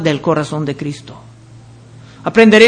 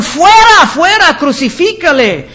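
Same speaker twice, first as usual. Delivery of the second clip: high, loud mock mob yell on the first Fuera; crowd shouting; 6 semitones higher, 3 dB louder than usual. Preacher voices the crowd demanding crucifixion, a retold yell. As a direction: let the first Fuera burst out, then settle. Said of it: "pero ellos gritaron".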